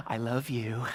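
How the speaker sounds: lightly voiced little girl, low-pitched. Little girl to an unknown woman, imitated earnest confession. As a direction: take a breath, then confess it in a small-child act. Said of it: "this little girl finally breaks the silence".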